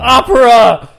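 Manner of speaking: loud blurt. Real, not acted. Blurts a guess.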